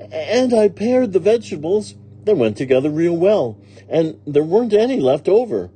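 high, loud, cheerful report; proud literal-minded maid. Acted. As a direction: report the job as a success.

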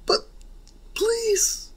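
"please" in a very high squeaky whine. Acted. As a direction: whine "please" like a pleading kid. Denki begs again, squeakier.

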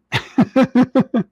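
sudden high, loud burst of laughter. Real, not acted. Host laughing at his own joke about waiting, high burst of laughter.

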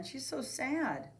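plain reading voice

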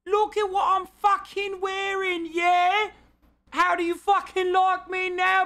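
very high, hammed-up British caricature; mock British accent. Defying people who make fun of his accent, high mock British accent. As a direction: push a British lout accent way up into falsetto. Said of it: "my British fucking accent".

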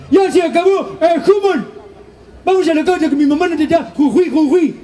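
mock brother ending the football game; kid calling out. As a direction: shout to your brother that mom wants him. Brother calls him home.

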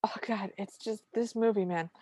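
usual speaking voice

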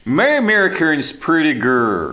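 slow, hammed-up American accent; put-on American accent. Shown as a demonstration. Australian showing off to co-hosts, exaggerated American accent. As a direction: drag it out in a caricature American accent. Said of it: "American accent?".

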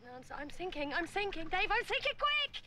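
climbing from worried to a high, loud cry; mounting panic. Acted. Sinking speaker begs companion to be quick, mounting panic. Sinking, calling Dave to hurry.

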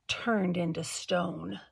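slow, hushed shudder of dread. A narrator's reading. Beaver shuddering as he tells the children of statues. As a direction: let the words shiver out slowly. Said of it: "he paused and shuddered".

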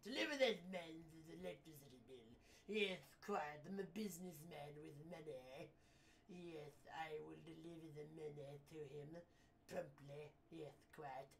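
low, soft, pompous businessman; hushed, self-important villainous gent; mustache-twirling businessman. Acted. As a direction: drone smugly while twirling a mustache. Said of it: "I twirl my mustache and he talks like this".

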